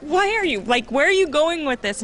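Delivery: high, pointed mom warning; Montana, where are you going with this, sharply. Retold or imitated. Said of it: "give her that look like".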